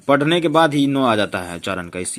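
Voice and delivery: ordinary talk, nothing marked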